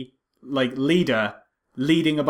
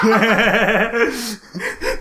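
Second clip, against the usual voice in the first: burst of laughter; 6 semitones higher, 3 dB louder than usual. Real, not acted. Laughing at Star Wars joke.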